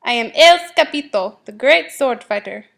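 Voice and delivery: swaggering little sword fighter; proud boast. A narrator's reading. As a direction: proclaim your grand title. Kitten boasts as hero.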